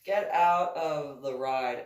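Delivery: normal talking voice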